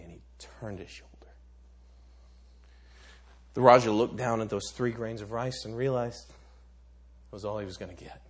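ordinary talk

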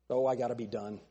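ordinary delivery

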